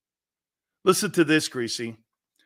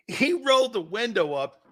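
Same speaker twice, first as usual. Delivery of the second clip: ordinary talk, nothing marked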